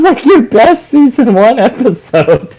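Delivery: ordinary delivery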